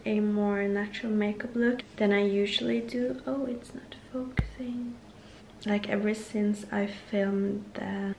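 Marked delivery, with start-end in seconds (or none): 3.3-4.9 whisper. Real, not acted.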